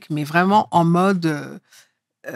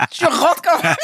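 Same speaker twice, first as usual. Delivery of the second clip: said through a real laugh, pitched higher; 6 semitones higher than usual. Real, not acted. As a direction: laugh your way through the punchline. Laughs at her own image. (en riant, voix plus aiguë)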